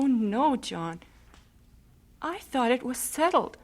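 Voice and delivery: normal talking voice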